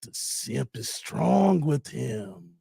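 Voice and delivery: low, slow mock Sith voice; a hint of Emperor. Streamer riffing on Star Wars, lightly voiced Emperor. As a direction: intone it like a dark lord.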